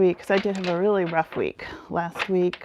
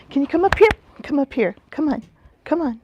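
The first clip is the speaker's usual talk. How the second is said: laughing, high coaxing call; calling the dog; 6 semitones higher, 4 dB louder, faster than usual. Real, not acted. To Sparky, calling him up onto the seat, excited.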